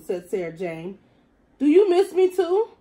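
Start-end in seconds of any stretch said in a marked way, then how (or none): 1.6-2.7 high, loud puppy-ish whine; sad dog voice. Acted. Lonely dog pleading with the cat to come home.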